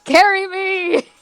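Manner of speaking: high, drawn-out mock plea; playful, stretched-out whine; whiny demand. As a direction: call out limply to be carried. Player voices limp character demanding carrying.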